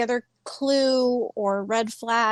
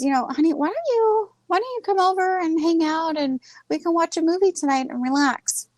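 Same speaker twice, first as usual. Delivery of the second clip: imitating sweet husband; 7 semitones higher, faster than usual. Husband coaxing his stressed wife to relax, sweet higher voice. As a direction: coax her gently like a caring husband.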